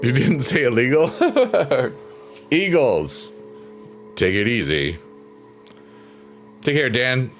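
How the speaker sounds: ordinary talk, nothing marked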